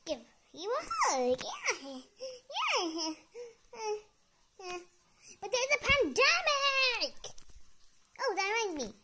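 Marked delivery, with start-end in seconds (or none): 5.4-7.2 protesting. Real, not acted. Refusing a hug.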